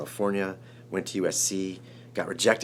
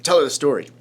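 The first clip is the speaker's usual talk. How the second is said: higher, much louder prompt; eager command; 6 semitones higher, 9 dB louder than usual. Retold or imitated. Director urging writer to tell his wife, commanding. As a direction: urge it out as an order.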